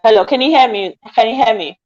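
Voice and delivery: ordinary talk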